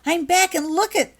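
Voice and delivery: high, loud gushing; thrilled, showing off a treasure; excited. Acted. Becky showing her new wand to Holly, high and thrilled.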